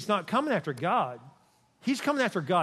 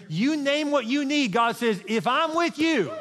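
higher and louder, climbing to a shout; preaching at full force; 9 semitones higher, 5 dB louder, slower than usual. Acted. Pastor voicing God to his people, climbing to a preaching shout. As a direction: build to a ringing cry, word by word.

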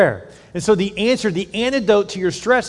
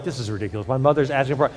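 muttered under the breath, much softer; eye-rolling mutter; 6 semitones lower, 5 dB quieter, faster than usual. Retold or imitated. Child in the car scoffing at mother's parking prayer, muttered. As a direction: grumble it to yourself like a sceptical kid.

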